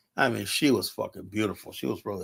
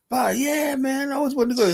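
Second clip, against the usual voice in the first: high put-on voice of the Nigerian customer, much higher; imitating Nigerian guy; 13 semitones higher than usual. Narrator mimics Nigerian customer answering him, high put-on voice.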